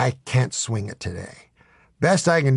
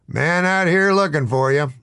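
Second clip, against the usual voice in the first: raised-pitch barkeep's call; hollered; 5 semitones higher, 3 dB louder than usual. Acted. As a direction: mildly holler to someone across the bar. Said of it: "hollered the barkeep".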